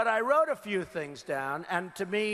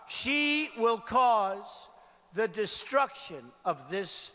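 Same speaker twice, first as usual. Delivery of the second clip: slow warning; slower than usual. Real, not acted.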